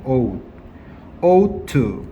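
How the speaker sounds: "ought" alone with a long o, then "ought to"; "ought to" in two distinct beats, "ought" stressed; pronunciation demo. Shown as a demonstration. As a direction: show learners the vowel of "ought". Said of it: "얘는 발음 어떻게 해야 되냐면".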